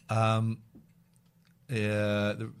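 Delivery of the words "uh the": ordinary talk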